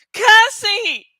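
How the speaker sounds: loud, high call of a name; yell. A narrator's reading. Narrator character calls out to Cassie, high loud yell. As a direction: shout out for a missing friend. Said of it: "I yelled".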